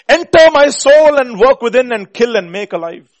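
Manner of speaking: loud, high preaching plea; impassioned cry. Real, not acted. Preacher pleading the hymn line to God, impassioned cry. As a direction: cry it out to heaven at full voice.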